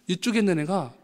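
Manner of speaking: ordinary talk, nothing marked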